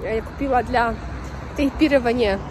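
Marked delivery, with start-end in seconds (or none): none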